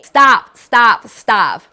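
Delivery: loud, firm repeated stops, each one fading. Real, not acted. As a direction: halt the viewer firmly, loud then easing off. Cutting into her own video.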